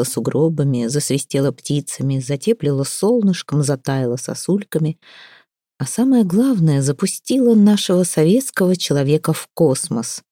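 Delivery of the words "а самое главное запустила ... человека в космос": ordinary delivery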